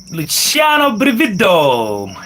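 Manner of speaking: Luciano Brivido called out high, each name drawn out; careful showy pronunciation. Real, not acted. Greeting Uncle Luciano by name, showy drawn-out pronunciation. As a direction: savour Luciano Brivido slowly, voice lifted. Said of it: "I think I pronounced that name well".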